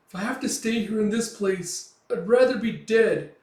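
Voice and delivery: high, loud, desperate protest; acted distraught shouting, easing slightly by the end; hysterical outcry. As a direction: cry out as if pushing everyone away. Teresia hysterically rejects Puck.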